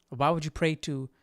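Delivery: usual speaking voice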